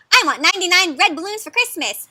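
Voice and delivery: put-on high, loud childlike wish; bouncy storybook request; eager little one. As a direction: ask like an excited small critter. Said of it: "says this little one".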